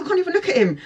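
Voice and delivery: high flustered squeal; nervous panic. Retold or imitated. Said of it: "He's making me nervous".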